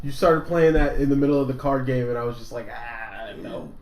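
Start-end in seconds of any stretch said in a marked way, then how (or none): 2.7-3.7 soft, whimpered little protest; mock dismay. Retelling his reaction to the creepy theme, mock dismay. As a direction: imitate a small, quiet whimper of dread.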